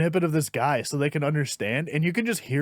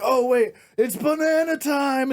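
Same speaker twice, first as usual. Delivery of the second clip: much higher, slow, cartoonish banana reveal; goofy announcement; 8 semitones higher than usual. Comic pulling out a banana prop, goofy high voice. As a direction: announce it like a silly kids' host. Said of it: "he's like".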